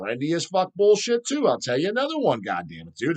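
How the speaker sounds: ordinary talk, nothing marked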